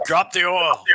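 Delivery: high, loud shouted command; yelled order. Acted. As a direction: yell it out like an urgent order. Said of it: "Cook yells out".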